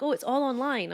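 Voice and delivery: hammed-up voice of other people; mock breezy quote. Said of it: "People are like".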